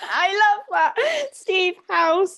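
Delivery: high, loud talk through laughter; tickled, squealing amusement; laughing delight. Real, not acted. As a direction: crack up while speaking. Cracking up at the story.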